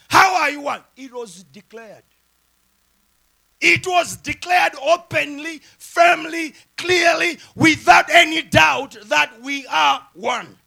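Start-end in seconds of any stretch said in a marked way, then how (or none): 3.6-10.6 high, loud, emphatic declaration; full-force preaching. Real, not acted. Preacher says the marriage was declared openly, forceful preaching. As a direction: punch each word, slowing toward the end.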